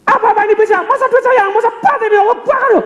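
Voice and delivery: hammed-up bank customer yelling; mock furious customer yelling at teller; angry customer shout. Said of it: "langsung malah ngomporin".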